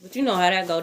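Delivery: ordinary delivery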